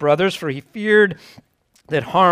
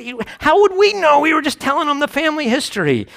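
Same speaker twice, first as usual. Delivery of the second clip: defensive sons; 11 semitones higher, faster than usual. Retold or imitated. Sons defending themselves to dad.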